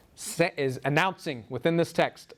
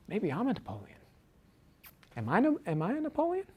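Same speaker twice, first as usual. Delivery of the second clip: rising to a much higher, faster wondering question; a lightly voiced Raskolnikov asking himself; 8 semitones higher, 4 dB quieter than usual. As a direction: voice his grandiose doubt, pitching up high.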